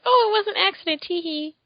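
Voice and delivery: high, sing-song fake innocence; hammed-up high tee hee; mock innocent. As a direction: play coy after the punch. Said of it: "It's like".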